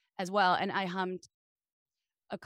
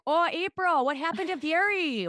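higher, louder Wisconsin mom; worried mom with Wisconsin accent; 8 semitones higher, 4 dB louder than usual. Retold or imitated. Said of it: "My mom's like".

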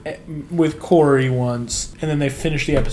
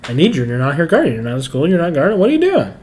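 quick, rising mock complaint; imitated calling out; 2 semitones higher, 4 dB louder, much faster than usual. Host acting Eric confronting his absent teacher, rushed complaint. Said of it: "Eric calls out the whole trope".

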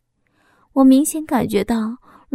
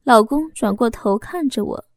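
plain reading voice

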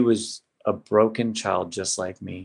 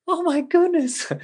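high mock gasp of alarm, slowed; startled nurse. Nurse sees patient hit the wall, mock shocked high squeal.

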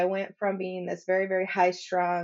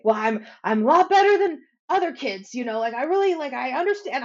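higher, louder defensive protest; 5 semitones higher, 6 dB louder than usual. Retold or imitated. Eighteen-year-old son answering his mother back, defensive. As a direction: imitate a teen insisting on his case. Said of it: "got a little defensive".

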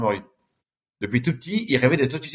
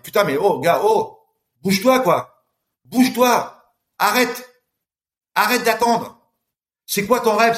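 raised, pushing pitch, shaking yourself awake; higher-pitched self-exhortation, half-shouted; self pep talk; 5 semitones higher, slower than usual. Pretend. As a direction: tell your mirror self to get moving. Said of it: "se mettre en face du miroir et dire".

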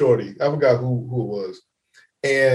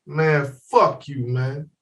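put-on slow drunk grumble; 4 semitones lower, slower than usual. Drunk friend brushes them off.

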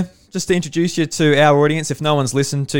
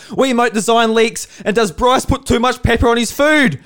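a lightly voiced, high, pumped-up podcast host; a host's opener pitched up and bursting with energy; Sean-style hyped intro; 7 semitones higher, 3 dB louder than usual. [name] mimics his guest's intro style, high and hyped. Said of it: "Is that what I sound like in my intros".

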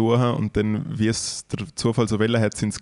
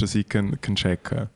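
usual speaking voice

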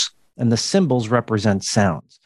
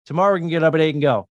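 rushed slurred speech; 3 semitones higher, much faster than usual. Shown as a demonstration. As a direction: blurt it out fast, sounds blended. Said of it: "if I assimilate and I say".

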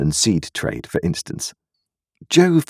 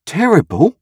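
high, rising, incredulous echo; shocked cry; 14 semitones higher than usual. A narrator's reading. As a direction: throw the word back in shock. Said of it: "cried Joe".

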